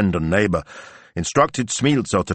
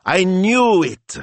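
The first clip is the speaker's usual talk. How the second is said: slow, high, loud triumph; triumphant; 13 semitones higher, 5 dB louder, much slower than usual. Acted. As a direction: gloat it out slowly. Antonella triumphant at cast list.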